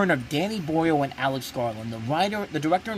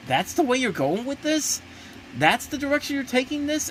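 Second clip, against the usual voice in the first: incredulous; 11 semitones higher than usual. Retold or imitated. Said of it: "It's just kinda like".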